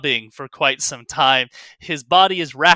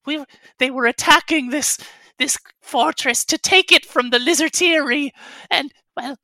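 high, loud creature voice, stumbling over words; tiny creature pleading its case; upset little kobold; 11 semitones higher, 6 dB louder than usual. Acted. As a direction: explain the disaster as a squeaky, anxious kobold. Plays the kobold.

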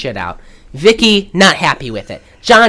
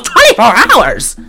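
raised, louder indignant question; hammed-up disbelief; mock shocked; 6 semitones higher, 5 dB louder than usual. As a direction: balk at it like a diva. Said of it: "And she goes".

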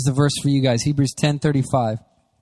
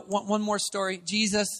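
normal talking voice

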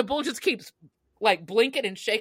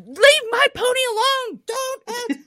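put-on childish yell. Little girl yelling at a bull to protect her pony, high shout.

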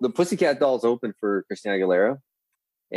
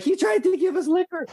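put-on much higher kid voice; tattling girl. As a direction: tell on him like a little girl. Girl tells mom.

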